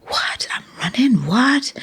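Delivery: rising stunned "What? I'm running?"; light mock shock, climbing at the end; a young runner hearing the news late at night; shocked disbelief. Shocked at being told to run.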